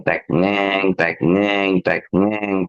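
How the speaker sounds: mock machine hum ngeng with a tek click; machine noise. Imitating the Sanyo machine under the dining table.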